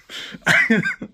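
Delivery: high, amused giggle; real laugh. Real, not acted. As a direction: crack up at the joke just quoted. Cracks up after quoting Drax.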